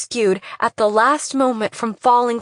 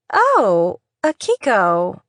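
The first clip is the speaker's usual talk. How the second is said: dazed; slower than usual. Acted. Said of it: "in a dazed voice".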